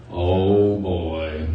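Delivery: low, glum donkey voice; low doleful cartoon donkey; Eeyore. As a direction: sink into gloomy dread, acted. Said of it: "Eeyore quickly realizes what's happened".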